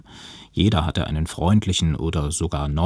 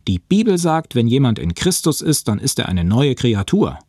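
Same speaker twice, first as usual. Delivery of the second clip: higher, emphatic indignant retort; 6 semitones higher, 4 dB louder than usual. A narrator's reading. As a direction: hit back with Scripture, affronted. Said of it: "Tonis Augen blitzten vor Empörung". (empört)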